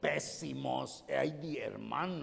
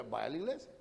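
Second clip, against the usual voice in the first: mimicked invitation; 5 semitones lower, faster than usual. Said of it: "Allá me puede hablar muy fuerte".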